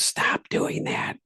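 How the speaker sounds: slow, whispery telling-off; hushed scolding. Retold or imitated. Companion poking him to drop the accent, hushed scolding. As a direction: imitate a hissed, drawn-out reprimand.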